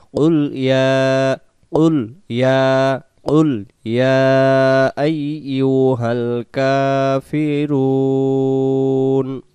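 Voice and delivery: ইয়া held for three beats, slow; three alif stretch on ইয়া. Shown as a demonstration. Teacher demonstrating the three-alif stretch, slow. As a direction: stretch ইয়া long in কুল ইয়া. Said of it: "ইয়া টান দেব তিন আলিফ".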